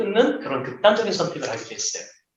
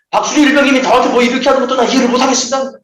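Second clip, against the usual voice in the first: fast, higher, louder rattled-off grievance; indignant junior's complaint; 6 semitones higher, 4 dB louder, faster than usual. Retold or imitated. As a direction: rush out the protest like an offended private. Junior complains against seniors.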